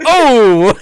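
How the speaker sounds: loud high oh of cringe; embarrassed yelp. Real, not acted. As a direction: yelp at the memory. Reliving embarrassment, shout.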